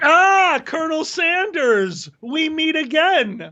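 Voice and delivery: high, slow theatrical villain greeting; mock arch-nemesis. Greeting Colonel Sanders the lawyer as an old enemy, hammed-up villain. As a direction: greet an old foe with grand relish.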